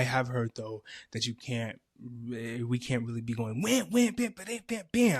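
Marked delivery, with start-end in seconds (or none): 3.9-5.2 mock sound effect. Mimicking sudden brash action.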